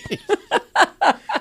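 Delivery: high cackling laugh at her own joke; burst of laughter. Real, not acted. Co-host laughing at her own cheating joke, high cackle.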